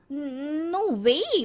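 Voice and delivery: very slow, drawn-out scoff; boasting of Switzerland with a lazy sneer; snooty dismissal. Acted. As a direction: stretch it out like it's beneath you. Bride dismisses Gulbarg.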